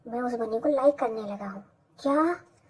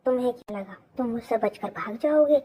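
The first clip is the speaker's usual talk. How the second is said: usual speaking voice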